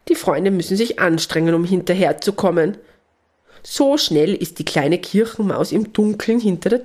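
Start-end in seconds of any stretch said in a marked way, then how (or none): none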